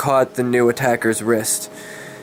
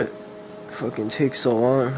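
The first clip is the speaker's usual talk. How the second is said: plain reading voice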